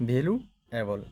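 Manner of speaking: "Valuable" broken in two, "value", pause, "এবল"; দুই ধাপে ভাগ করা উচ্চারণ. Shown as a demonstration. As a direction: split "valuable" into two parts with a gap. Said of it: "দুইটা syllable". ("Valuable" মাঝখানে থেমে দুই ভাগে)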